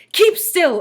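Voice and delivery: high loud command; ordered. A narrator's reading. Teacher ordering Todd, high loud command. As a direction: bark the order at him.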